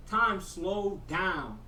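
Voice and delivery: stretched out very slowly; slowed-down mock trance; slow-motion drawl. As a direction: drag each word like time stopped. Slow-motion retelling of high.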